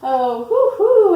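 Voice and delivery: high, loud, long hoots; owl hoot. A narrator's reading. Reader voices the owl in a picture book, hooting. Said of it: "asked the owl".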